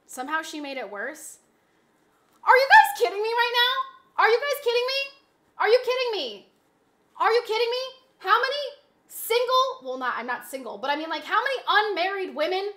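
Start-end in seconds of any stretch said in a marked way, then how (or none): 2.4-9.7 loud, high, incredulous yelling; outraged disbelief. Real, not acted. Streamer yells at chat mocking her tie, loud and outraged. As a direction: blast the chat in real outrage.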